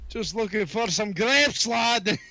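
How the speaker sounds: yelling back. Acted. Adventurer answers an important-looking guard, yelling back. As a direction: yell it back at him. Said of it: "I just yell at him".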